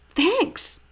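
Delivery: high-pitched little thanks, rising at the end; teased boy replying. A narrator's reading. Stink to friends who mocked his frog sounds, high-pitched reply. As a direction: pipe it up high.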